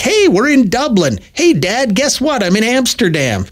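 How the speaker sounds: high, sing-song travel boast; pitched well up, teasingly chirpy; imitating excited daughter. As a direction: mimic a gleeful kid phoning dad. Imitating daughter's gleeful travel calls home.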